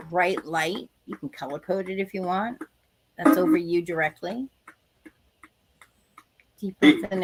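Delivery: calm, slow meditation guidance; guided meditation. Acted. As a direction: guide them gently into protection. Leads a protection meditation.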